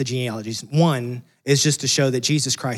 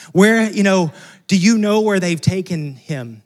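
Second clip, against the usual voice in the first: louder, higher pleading burst; desperate plea; 5 semitones higher, 5 dB louder than usual. Retold or imitated. Mary begging the gardener for the body, desperate loud plea. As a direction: beg for an answer, desperate. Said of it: "you're, like, desperate".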